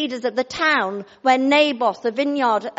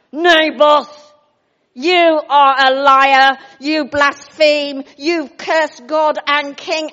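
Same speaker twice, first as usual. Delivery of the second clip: loud, slow accusing liars; loud accusation; 10 dB louder, much slower than usual. Acted. Scoundrels accusing Naboth before the town. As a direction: denounce him before the crowd.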